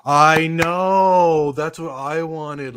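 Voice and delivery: high, loud, drawn-out outburst; emphatic agreement. Real, not acted. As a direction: belt out wholehearted agreement. Heartily agrees with viewer's comment.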